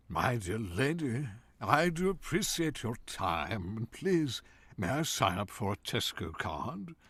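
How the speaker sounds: slow, stately British thespian; Ian McKellen. Retold or imitated. Student impressing the Tesco cashier, in a slow, grand British actor's voice. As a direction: savour each word like a grand English stage actor. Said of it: "Ian McKellen impression".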